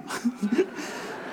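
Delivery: high unvoiced giggle; breathless laugh. Real, not acted. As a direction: break into a wheezy laugh. Laughing at his own impression.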